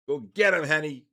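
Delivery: loud, high egging on of the dog; excited cheer. Real, not acted. Owner cheering his dog on, loud excited egging.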